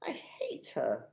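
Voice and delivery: put-on slow breathless whisper of a hurt girl; hushed and bitter whisper to the old tramp. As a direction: whisper it, fighting back tears. Chloe near tears, whispering.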